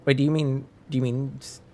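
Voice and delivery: ordinary talk, nothing marked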